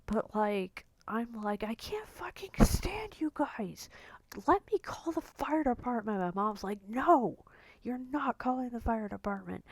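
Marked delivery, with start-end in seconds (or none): 1.6-6.2 high-pitched, strained re-enacted fury; exasperated outburst, retold. Re-enacts exploding at both parents. 6.9-9.6 imitating an angry mother, higher pitched; firm refusal, retold.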